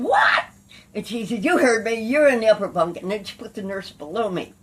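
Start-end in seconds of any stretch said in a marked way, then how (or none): 0.0-0.4 loud, very high yelp of disbelief; retold outraged disbelief. Outraged reply to bunk order. 1.4-3.0 loud, higher, no-argument command; retold cop's insistence. Cop insists on the order.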